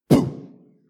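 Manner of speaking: sudden loud voiceless "boom"; explosive breathy hit sound; imitated impact noise. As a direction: burst it out like a punch landing.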